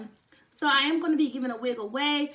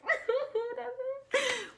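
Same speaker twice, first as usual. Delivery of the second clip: happy high laugh; 12 semitones higher than usual. Real, not acted. Laughs at the milestone.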